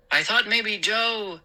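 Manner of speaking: unfinished thought left hanging; trailing off. Acted. Father wondering about his son, trailing off. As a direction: let the last word fade away. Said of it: "His voice trailed off".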